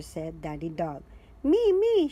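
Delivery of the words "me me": high, loud me me; pony shouting to be goalkeeper; eager shout. A narrator's reading. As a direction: shout to be picked. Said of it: "shout Pedro Pony".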